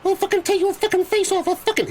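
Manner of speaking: high, far-off menacing thug. Acted. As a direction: menace him like a pub brawler. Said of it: "he kicks the headlamp".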